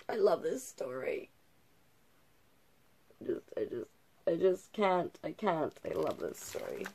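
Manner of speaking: usual speaking voice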